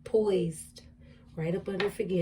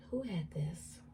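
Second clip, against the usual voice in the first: soft whispered question, almost voiceless; murmured under the breath; whispered aside; 6 dB quieter, slower than usual. Real, not acted. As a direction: whisper it to yourself. Muttered question to herself over cards.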